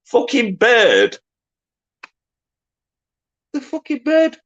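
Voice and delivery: high, slow squeal of disbelief; can't believe the answer; incredulous. Real, not acted. Quiz host stunned at the bird answer, high slow incredulous squeal.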